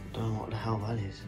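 ordinary delivery

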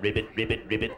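soft, low ribbit croaks; frog croaking. Pretend. The first amphibian croaking, soft and low. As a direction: croak quietly like a little frog.

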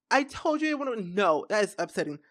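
usual speaking voice

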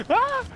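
sudden high-pitched shriek. Real, not acted. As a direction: shriek in panic. Being chased in game.

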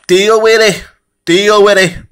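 high, loud, confrontational retort; loud, high-pitched defiance; defiant shout. Real, not acted. Telling off a critical commenter.

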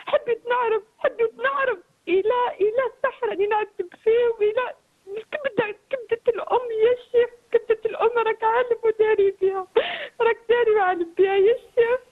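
sobbing out her words; crying. Real, not acted. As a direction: voice breaking with grief. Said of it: "هاد البكا اللي راكي تبكي".

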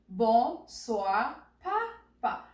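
"Bonsoir, Papa" slowly, each syllable apart. Shown as a demonstration. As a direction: model "Bonsoir, Papa" beat by beat. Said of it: "Repeat after me".

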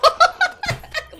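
high, loud burst of giggling; high giggle. Real, not acted. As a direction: giggle at yourself. Laughs at own demo.

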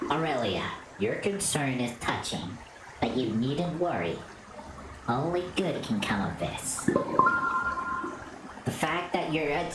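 low, calm ancient spirit; wise spirit voice. Acted.

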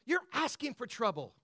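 ordinary talk

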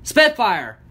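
loud call to a mount. A narrator's reading. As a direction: call the name out sharply. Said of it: "Smurf Storms called".

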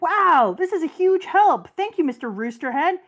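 Luffy gratefully thanking the man who turned around for him; excited Luffy. Retold or imitated. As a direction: bounce it out like a thrilled kid. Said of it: "Luffy is like".

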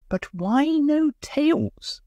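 high, very slow frightened question; shivering with fear and rage; chattering fear. A narrator's reading. Cold scared Waldo asking Bertie, high and slow. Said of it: "teeth were chattering with fear and rage".

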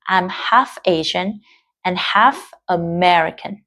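slowly, stressing "half"; slow model reading. Shown as a demonstration. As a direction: read slowly with clear halves. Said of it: "lưu ý cho Moon cái từ khó". (đọc mẫu chậm, nhấn "half")

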